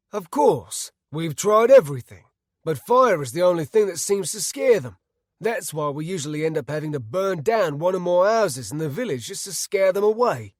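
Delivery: a frustrated villager stating the facts loudly; forceful villager. A narrator's reading. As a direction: villager telling the group what they have tried, loud and frustrated.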